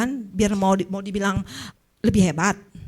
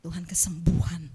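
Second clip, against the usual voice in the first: hushed, breathy murmured prayer for healing; preacher mimics a whispered prayer for healing; 4 semitones lower, 6 dB quieter than usual. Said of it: "Paling saya doakan".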